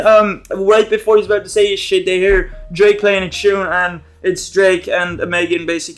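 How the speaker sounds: ordinary talk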